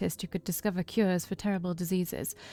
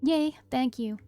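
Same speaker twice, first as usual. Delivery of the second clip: delighted little sister, higher country lilt; pitched-up, pleased country twang; attempted country accent; 5 semitones higher, slower than usual. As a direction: cheer happily in a country accent. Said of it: "country accent that I was trying to do".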